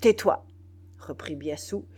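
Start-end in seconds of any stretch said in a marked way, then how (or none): 0.0-0.4 loud, abrupt command; mounting anger. A narrator's reading. General silencing the trembling soldier, angrily. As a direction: cut them off with force. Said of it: "dont l'emportement semblait croître".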